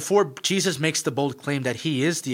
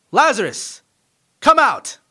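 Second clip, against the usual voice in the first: shouted command into the tomb, much higher and louder; loud call; 12 semitones higher, 10 dB louder than usual. Acted. Jesus calling Lazarus out of the tomb, loud shout. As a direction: call him out loudly. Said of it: "With a loud voice".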